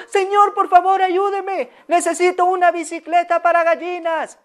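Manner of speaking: high, urgent calling-out plea; pleading owner. Acted. Owner runs out begging the vendor, high and urgent. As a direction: chase him down and beg for help. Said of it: "salió".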